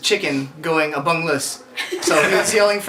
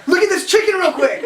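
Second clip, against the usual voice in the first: higher, loud, urgent call; 13 semitones higher, 4 dB louder than usual. Retold or imitated. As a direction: yell it across the house. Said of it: "he was like".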